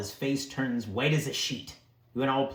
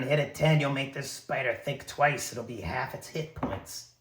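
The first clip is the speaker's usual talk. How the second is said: ordinary talk, nothing marked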